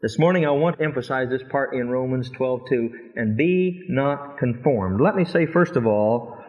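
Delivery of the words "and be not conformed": And be not conformed, every word slow and weighted; solemn slow scripture reading; slow emphasis. Shown as a demonstration. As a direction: read it slow, like a command. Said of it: "an imperative verb".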